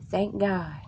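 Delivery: ordinary talk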